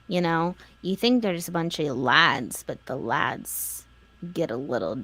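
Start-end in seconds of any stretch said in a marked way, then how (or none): 2.7-5.0 low, slow and ominous. Acted. Joking about the lads in a low ominous voice. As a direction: lower the voice like telling a dark secret. Teasing dungeon talk.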